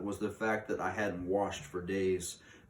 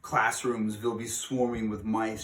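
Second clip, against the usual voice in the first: shouted; 3 semitones higher, 5 dB louder than usual. A narrator's reading. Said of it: "shouted the Grand High Witch".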